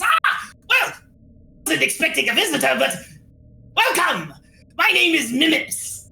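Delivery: excitable little merchant creature, pitch swooping up; Mimips. Acted. Little merchant welcoming unexpected visitors, creature voice. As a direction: greet visitors in a perky goblin-like voice.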